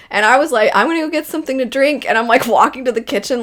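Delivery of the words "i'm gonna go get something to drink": high, hurried excuse to escape; rushed, mock-composed and higher pitched; holding it together. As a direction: imitate someone keeping tears at bay. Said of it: "trying to hold it together".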